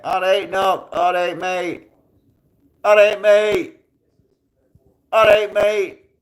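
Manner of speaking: high-pitched, increasingly loud mimicry of surrender; mock a frightened man backing down; panicked placating. Instigator with hands up on seeing him, panicked placating.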